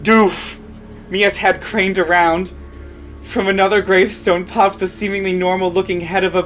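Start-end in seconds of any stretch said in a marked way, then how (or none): none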